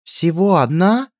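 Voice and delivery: high, slow, appalled question; aghast at losing her maids; horrified disbelief. A narrator's reading. Princess horrified at the lackey's news, high slow gasp. Глаза принцессы наполнились неподдельным ужасом.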